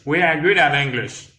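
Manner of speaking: words linked at a natural pace; connected demonstration. Shown as a demonstration. Teacher repeats an English phrase for learners, joined up. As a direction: say it joined up, in one flow.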